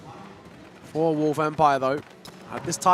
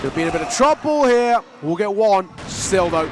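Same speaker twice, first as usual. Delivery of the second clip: excited casting; 4 semitones higher, 4 dB louder than usual. Real, not acted. Clutch moment call.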